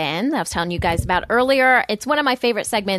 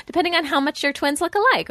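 ordinary talk, nothing marked

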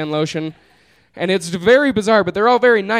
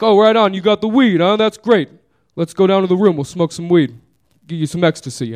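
put-on heavy New York accent; 3 dB louder than usual. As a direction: imitate an eager New Yorker. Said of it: "guy with a very heavy New York accent".

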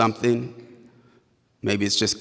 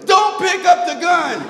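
high, loud panicked-sounding yell; Pam yelling; 7 dB louder than usual. Retold or imitated. Pam warning her companion against the officers' order, yelling. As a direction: yell a desperate warning at full volume. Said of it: "Pam yells".

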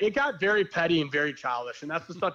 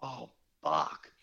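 dismayed; 13 semitones lower, 4 dB quieter than usual. Retold or imitated. Dismayed reaction to an argument.

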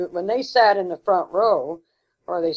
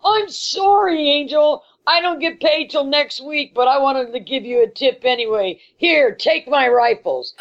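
put-on old prospector; 6 semitones higher than usual. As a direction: play a gruff gold miner apologizing. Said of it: "he goes".

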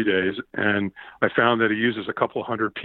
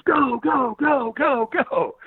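high-pitched mock yelling; rapid-fire high command to get moving; imitated urging; 12 semitones higher, much slower than usual. Trainer sending him onto coals, high urgent cheer.

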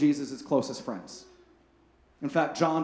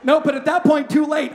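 louder, higher, through real laughter; laughing; 8 semitones higher, 7 dB louder than usual. Real, not acted. Pastor laughing at his own phone gaffe to the congregation. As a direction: laugh off your own blunder.

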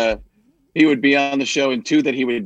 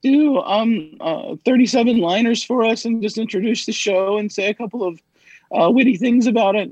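lightly voiced producer, higher pitch; producer's request; 8 semitones higher than usual. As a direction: pitch it up like a producer asking a favor. Voices a producer asking a favor.

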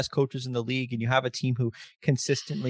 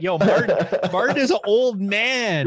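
teasing laughing outburst; 8 semitones higher, 6 dB louder, slower than usual. Real, not acted. Teasing Martin for being old, a loud laughing outburst. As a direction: roast your friend with delighted mock shock.

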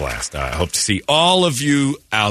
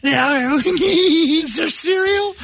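put-on kid voice; much slower than usual. Kid asking for cereal.

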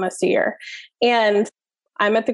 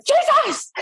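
high, sudden exasperated yell; reenacted frustrated outburst, pitched way up; retold yell. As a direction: blurt it out like you've had enough. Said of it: "I yelled at the self-checkout".

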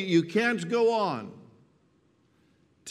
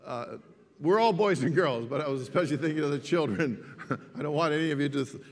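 normal talking voice

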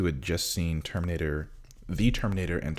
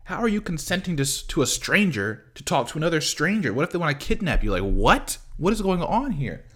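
high, loud, exasperated rant; real incredulous outburst; outraged disbelief; 10 semitones higher, 5 dB louder than usual. Real, not acted. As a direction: go up and loud, appalled at the screen. Outraged rant about kidnapping scene.

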